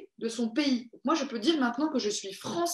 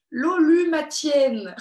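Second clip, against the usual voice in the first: laughing; 3 semitones higher, 5 dB louder, much slower than usual. Real, not acted. Stumbles over a made-up name.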